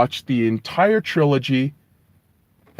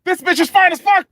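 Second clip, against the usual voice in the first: much higher, giddy lovestruck voice; mock-dreamy Frodo, pitched way up; smitten Frodo; 11 semitones higher than usual. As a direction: gush like a besotted hobbit. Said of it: "you're like".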